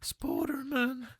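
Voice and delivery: very high, drawn-out goofy voice; softly squeaked, slow cartoonish voice; silly Spooderman voice. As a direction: stretch the name out softly, way up high. Playing with a silly mispronounced name.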